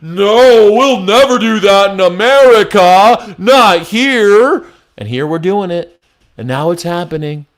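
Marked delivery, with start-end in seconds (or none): 0.0-4.7 higher, louder scoffing impression of smug denial; sneering mock-innocent protest; mock naive denial. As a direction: mimic the people who swore it couldn't happen here. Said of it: "everyone's like".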